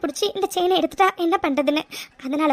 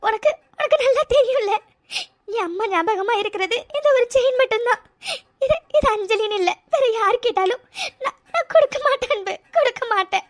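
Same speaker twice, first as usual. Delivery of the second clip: tearful refusal, voice catching on the words; cling to mother's chain, fighting back sobs; crying; 3 semitones higher than usual. Acted. Refusing to give up her mother's chain, in tears. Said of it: "அழாத".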